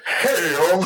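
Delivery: strained, breathy "Hell"; imitated gasp of pain. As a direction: wince it out like a winded fighter. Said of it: "he said".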